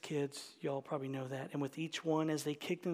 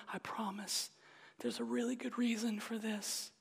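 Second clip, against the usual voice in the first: hushed, reassuring promise; soothing father; 6 semitones higher than usual. Retold or imitated. Father to his screaming hungry baby, soothing.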